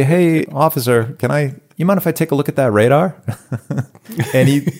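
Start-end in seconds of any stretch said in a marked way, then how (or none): 0.0-3.2 higher, louder, cheeky ask of the officer; cocky mock request. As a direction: play it as a brash kid testing the cop.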